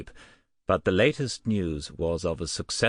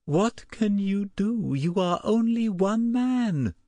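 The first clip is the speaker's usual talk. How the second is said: much higher, slow, desperate wife's voice; tearful wife. A narrator's reading. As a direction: plead through tears. Tearful wife confronting husband.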